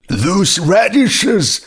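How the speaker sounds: high, slow, delighted cry of recognition; excited exclaim. A narrator's reading. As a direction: gasp out the word radishes in wonder. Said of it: "he exclaimed".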